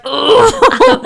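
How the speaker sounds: high, loud exaggerated oh; hammed-up high groan of reluctance; mock reaction. Acts reaction to bad reviewer's request.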